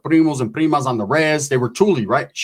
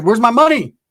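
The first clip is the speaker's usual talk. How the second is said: mock angry demand; 7 semitones higher, 4 dB louder than usual. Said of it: "arguing with the paisas".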